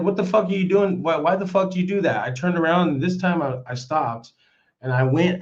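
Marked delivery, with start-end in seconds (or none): 0.0-2.3 fast, raised demand; mild retold anger; retold angry confrontation. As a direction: tear into him, quickly. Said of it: "I said".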